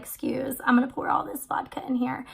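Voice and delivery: conspiratorial whisper; sneaking vodka on the plane, whispering; sneaky whisper. Retold or imitated. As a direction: whisper it so the crew can't hear. Sneaking vodka past attendants.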